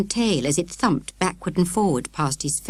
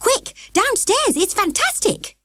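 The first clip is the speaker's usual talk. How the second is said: high, thrilled calling; excited shout; slower than usual. A narrator's reading. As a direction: urge Dad to come, thrilled. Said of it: "he shouted".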